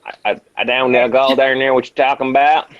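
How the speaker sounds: hammed-up Texan accent; mock Texan. Friend parodying the attempt, mock Texan accent. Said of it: "Texan accent".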